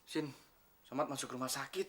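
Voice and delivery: low, very soft voice; subdued bad news. Telling Sin that Somad is in hospital, subdued. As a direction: break the news gently and quietly.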